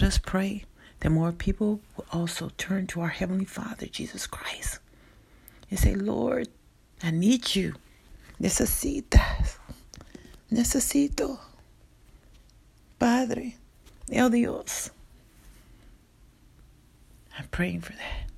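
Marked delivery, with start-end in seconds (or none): 5.9-14.9 pleading prayer, pitched higher; fervent prayer. Acted. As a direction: cry out to God earnestly. Voicing a heartfelt prayer.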